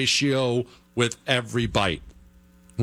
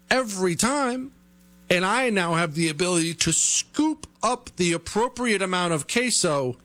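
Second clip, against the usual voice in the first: ordinary talk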